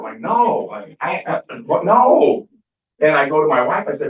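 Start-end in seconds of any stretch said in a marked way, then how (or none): 0.2-2.5 rising, stammering, ending in a high loud "No"; flustered denial. Retold or imitated. Denying Botox to his daughter, flustered and rising to a high outburst. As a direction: sputter it, then burst out the last word.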